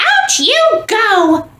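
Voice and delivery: high, bossy little squeak; squeaky mouse voice. A narrator's reading. Mouse evicting the statues, bossy squeak. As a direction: shoo them out in a tiny mouse voice. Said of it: "Mortimer said".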